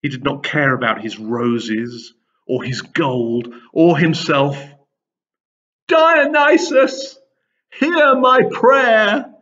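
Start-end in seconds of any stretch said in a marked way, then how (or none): 5.9-9.3 high, slow desperate plea to a god; anguished father begging Dionysus. A narrator's reading. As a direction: cry out to the god, pleading for help. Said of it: "the king begged".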